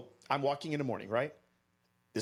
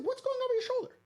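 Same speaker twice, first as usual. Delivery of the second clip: way up high, sweet and concerned; high friendly question; faster than usual. Retold or imitated. Asking a lady about her sling, high sweet concern. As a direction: ask brightly in a high voice.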